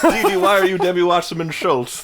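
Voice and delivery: put-on high, accented old lady; scolding grandma. Voicing the grandmother.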